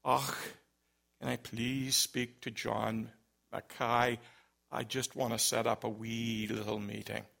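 low, soft Scottish caller imitation; thick Scottish accent. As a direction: quietly repeat the request as a put-on Scot. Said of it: "'cause it was the same voice".

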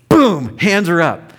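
triumphant burst. Real, not acted. Triumphant retelling of altar call.

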